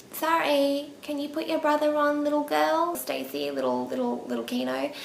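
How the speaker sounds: high, mock-sweet phone voice; talking to a child. Asking little girl for her brother, mock-sweet high voice. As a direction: speak as if a little girl picked up.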